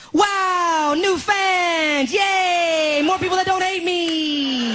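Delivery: high-pitched mock-elated shouting; hammed-up drawn-out cheering. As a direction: cry out in exaggerated joy. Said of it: "That's how his emails sound to me".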